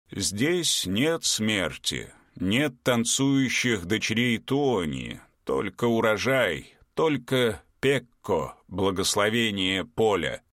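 slow, solemn giant voice; slow ancient giant. Acted. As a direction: speak like an old field spirit. Giant Pekko speaks.

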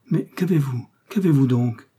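woman frightened as he grips her wrists; terrified tone. A narrator's reading. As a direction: ask in sudden fright. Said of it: "d'un ton d'épouvante".

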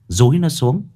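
ordinary delivery, nothing marked

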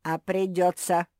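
loud, gleeful rubbing-it-in; gloating. A narrator's reading. Basil answers Kuki's whining, gloating. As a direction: say it with malicious relish. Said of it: "злорадно констатировал Базиль".

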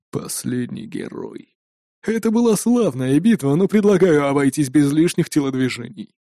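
low hiss of a cold killer; menacing raider voice. Acted. Raider addresses the hero.

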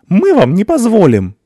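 very high, loud defiant scream; determined. A narrator's reading. Mother screams defiance at the officer, very high and loud. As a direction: refuse them with a shriek. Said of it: "решительно сказала женщина".

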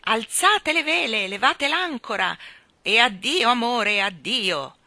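loud, hearty sea captain; booming sailor farewell. A narrator's reading. The admiral bids farewell, booming. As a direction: bellow it like casting off.

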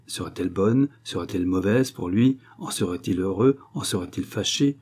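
usual speaking voice

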